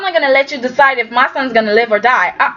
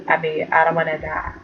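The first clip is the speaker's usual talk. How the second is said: softer, lower frightened murmur; quiet scared mumble; 7 semitones lower, 7 dB quieter than usual. Retold or imitated. Ashley scared for herself, quiet lower frightened mumble. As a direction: mumble it low like she's scared.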